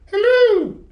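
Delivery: very high, loud wooden puppet voice; a newborn puppet greeting brightly; puppet boy's hello. Acted. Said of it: "he said".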